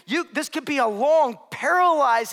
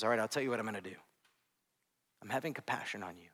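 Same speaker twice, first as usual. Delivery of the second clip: compassionate; 13 dB quieter, faster than usual. Acted. Master forgiving the servant.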